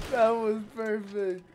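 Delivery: loud, slow words after a laugh; laughing delight. Real, not acted. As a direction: still cracking up, high and loud. Still cracking up at game moment.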